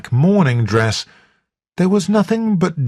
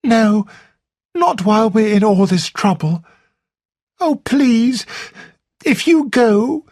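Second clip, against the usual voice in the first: put-on young woman pleading, voice climbing higher; begging him to stay, rising higher; pleading Flora; 9 semitones higher than usual. A narrator's reading. Flora begging Blunt not to leave, higher and pleading.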